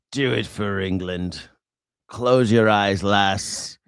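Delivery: lightly put-on British accent, low and gruff; a hint of a crude Englishman telling his lass to shut up; mock British bloke. Streamer mocking British patriots in a put-on British accent. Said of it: "British people love being poor".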